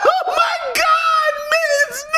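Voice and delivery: high slow wail, half laughing; hammed-up panicked cry; mock panic.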